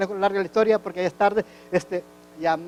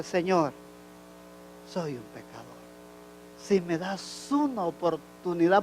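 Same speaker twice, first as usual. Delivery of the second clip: quiet tearful prayer; 2 semitones lower, 9 dB quieter than usual. Retold or imitated. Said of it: "ahí se lloré y le dije".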